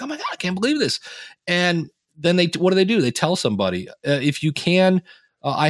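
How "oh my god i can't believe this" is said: starstruck fan. Retold or imitated. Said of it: "I'm like".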